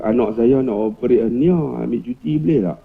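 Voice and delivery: low, even request; pitched well down, deferential. Acted. Teacher asks the principal for child's operation leave, low tone. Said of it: "kita buat nada rendah".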